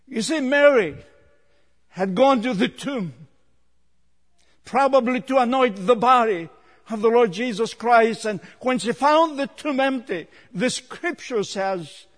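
high, projected preacher's voice; impassioned preaching. Real, not acted. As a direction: tell the Bible story from the pulpit with force. Preaches at full force.

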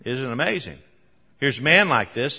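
ordinary delivery

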